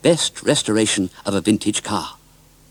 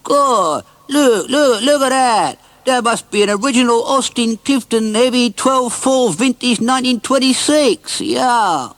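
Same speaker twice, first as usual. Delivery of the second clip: much higher, loud amazed voice; excited exclamation; 13 semitones higher, 4 dB louder, slower than usual. Acted. A passer-by spotting Gumdrop, high excited exclamation. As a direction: gasp it out in wonder. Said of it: "A man exclaimed".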